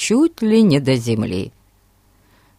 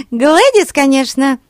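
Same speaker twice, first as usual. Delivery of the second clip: put-on high, giggly little girl; giggling girl; 10 semitones higher, 6 dB louder than usual. A narrator's reading. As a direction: say it through a giggle. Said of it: "хихикнула Глэд".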